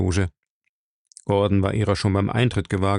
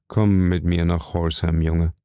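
calm firm father; 5 semitones lower than usual. Acted. Father quietly summons son.

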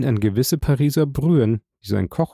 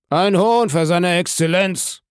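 shouted order; 7 semitones higher than usual. A narrator's reading. Bandit bellowing the prisoner's order to the kitchen, narrated shout. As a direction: call it down the vaults like a waiter. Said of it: "schrie mit lauter Lunge".

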